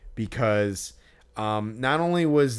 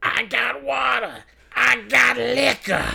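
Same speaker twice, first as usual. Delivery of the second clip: high, slow hawking cry; drunk vendor imitation; 12 semitones higher, 4 dB louder, slower than usual. Drunk vendor hawking to the crowd, high and slow. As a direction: bellow it like a drunk street vendor. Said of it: "he's like".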